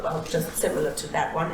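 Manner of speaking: ordinary talk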